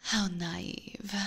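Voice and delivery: ordinary delivery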